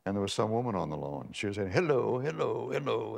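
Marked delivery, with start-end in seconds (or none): 1.7-3.2 high, affected Park Avenue society voice; mock Boston society lady accent; phony Boston Park Avenue accent. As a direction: put on a phony upper-crust Boston accent, pitched up. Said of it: "this terribly kind of phony".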